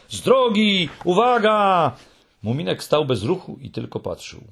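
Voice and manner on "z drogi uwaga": high, drawn-out warning call; high-pitched cry from something hurtling down; shouted warning. A narrator's reading. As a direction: call out to clear the way. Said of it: "i krzyczało".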